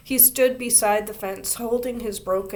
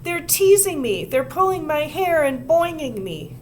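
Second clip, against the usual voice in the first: high, loud tearful complaint; complain, near tears; upset whining; 8 semitones higher, 6 dB louder than usual. A narrator's reading. Upset girl complains to teacher.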